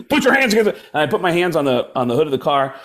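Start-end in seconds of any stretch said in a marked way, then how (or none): 0.1-0.8 high, sudden mock cop bark; barked police order. Cop orders suspect against the car, barked sharply. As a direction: bark it as a command.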